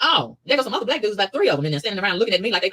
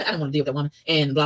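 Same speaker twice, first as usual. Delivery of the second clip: ordinary talk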